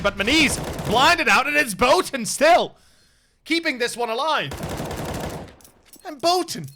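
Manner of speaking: excited play-by-play. Real, not acted. Caster calling a one-versus-two clutch to viewers, excitedly. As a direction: pitch climbing high as the clutch unfolds.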